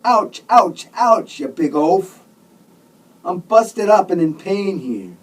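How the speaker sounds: loud, high yelps of protest; pained yelling. A narrator's reading. Injured patient yells at the fool hugging him, pained. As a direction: yelp and yell to get him off. Patient in pain being hugged.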